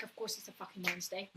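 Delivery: low, quick, under the breath; resigned aside. Retold or imitated. Host recalling her reaction to the day, resigned aside. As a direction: drop it to a fast, soft grumble.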